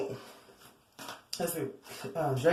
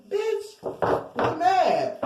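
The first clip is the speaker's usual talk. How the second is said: much higher, hammed-up furious; mock angry snap; 9 semitones higher, much slower than usual. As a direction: shriek it like a sulking crowd. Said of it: "and they was like".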